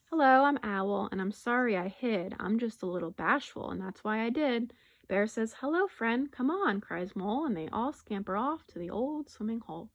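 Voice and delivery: animated, expressive story narration; picture-book read-aloud. Acted. Teacher reading a rhyming bear story to pupils, animated storytelling. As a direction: read it lively to young children.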